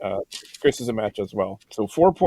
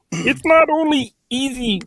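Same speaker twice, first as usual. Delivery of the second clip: bad Kermit voice; 8 semitones higher than usual. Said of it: "despite my horrible accent".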